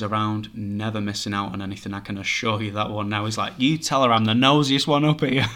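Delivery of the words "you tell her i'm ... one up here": cheeky boast. Retold or imitated. Cheeky spirit boasting, laughing.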